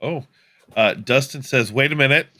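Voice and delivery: ordinary delivery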